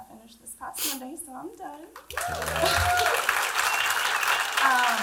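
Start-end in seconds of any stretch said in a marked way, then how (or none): 1.9-4.6 a bright burst of real laughter; relieved laugh. Real, not acted. Patient laughing as she says treatment is finished, relieved laugh. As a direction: laugh out the relief that it's over.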